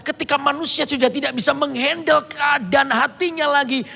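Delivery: high-pitched, driving sermon voice; fervent preaching. Acted. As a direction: preach it to the congregation with fire. Preaching at full force.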